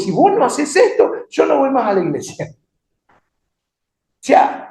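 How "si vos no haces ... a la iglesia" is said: high-pitched petulant threat; mock angry ultimatum. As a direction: imitate someone in a huff with God. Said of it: "vos te enojás con Dios".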